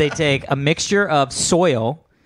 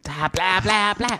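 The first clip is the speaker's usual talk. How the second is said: mouth on fire babble; 4 semitones higher, slower than usual. Retold or imitated. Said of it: "being like".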